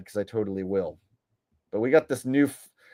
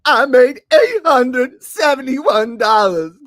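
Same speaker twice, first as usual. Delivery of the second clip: high, loud and slow parody of him; mocking his boast, high and slow; mock whiny echo; 8 semitones higher, 9 dB louder, slower than usual. Mocks the TikToker's line.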